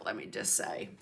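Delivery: breathy whispered aside, voice dropped low. Real, not acted. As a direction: let it slip out as a hushed whisper to yourself. Aside while tech is messed up.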